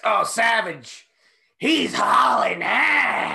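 high, slow drawn-out announcer yell; mock commentator shout. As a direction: call it out like a ringside announcer.

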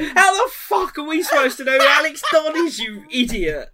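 high, loud mock outrage; laughing exasperated shout. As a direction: yell it at him through laughter. Retold mates shouting at Joe.

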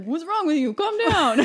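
high, loud hammed-up protest; mocking imitation of a terrible actor; bad actor. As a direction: overact it badly, building to a yell. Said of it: "kind of a bad actor".

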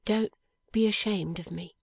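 lightly voiced young wife, tender and earnest; a hint of Lizzie. A narrator's reading. Young wife answering her dying husband, gently earnest.

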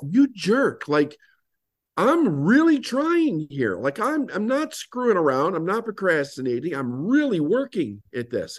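high-pitched frustrated protest to himself; exasperated inner rant. Retold or imitated. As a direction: whine it out as if nobody believes how hard you try. Inner rant at mentor mocking him.

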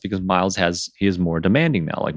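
ordinary talk, nothing marked

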